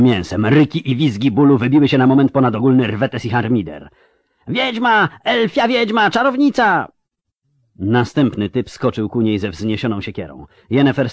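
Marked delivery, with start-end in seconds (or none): 4.5-6.9 high, shrill-pitched crowd yells of hatred; mob screaming. Acted. The mob shrieks at Yennefer, high hateful screams.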